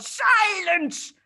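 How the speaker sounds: angry yell. A narrator's reading. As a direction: whirl round and yell. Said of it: "wheeled round and yelled".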